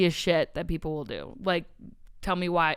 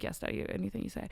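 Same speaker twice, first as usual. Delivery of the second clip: fast, breathy little whisper playing along; mock submissive whisper; 9 dB quieter, faster than usual. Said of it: "where I'm like".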